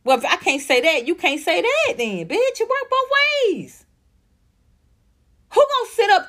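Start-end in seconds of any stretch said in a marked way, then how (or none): 2.3-6.3 indignant shriek. Real, not acted. Ranting to viewers about double standards, pitch shooting up indignantly. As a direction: voice jumps high on the outrage.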